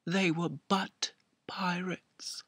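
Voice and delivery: hushed confiding whisper. Acted. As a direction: drop to a secretive whisper, slow. Confiding a shameful secret.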